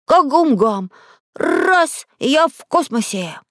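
put-on high, bold little-boy boast; daring kid, voice leaping up; brave. A narrator's reading. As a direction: launch it with childish bravado. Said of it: "храбро сказал Зайчик".

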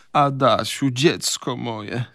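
pained voice. A narrator's reading. Mother appealing to her child, in a pained voice. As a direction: draw it out in sorrow. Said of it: "odezwała się zbolałym głosem".

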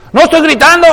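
high, loud shouted denial; mock shouting. Loud Caribbean speaker denying it to a Mexican, mock shout. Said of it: "¿Por qué me gritas?".

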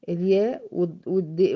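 ordinary talk

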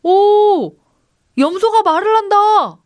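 high, loud crowd amazement; high-pitched excited shout of wonder; astonished exclamation. A narrator's reading. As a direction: cry out at a marvel. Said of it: "사람들의 눈이 휘둥그레졌습니다".

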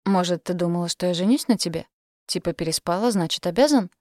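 ordinary delivery, nothing marked

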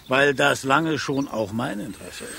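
ordinary talk

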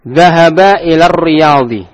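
slow, drawn Arabic reading; slow demo; slow model answer. Shown as a demonstration. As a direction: read it out slowly to the class. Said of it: "Aina Aliyun ya ikhwanu?".

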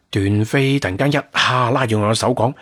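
ordinary delivery

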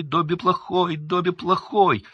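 high, loud elf wail; frantic self-scolding. A narrator's reading. Dobby banging his head on the table, frantic and high. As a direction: punish yourself aloud in a squeal. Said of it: "приговаривая".

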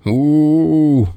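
howling bear's cry of pain. A narrator's reading. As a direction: let out a long animal howl. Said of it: "взвыл медведь".

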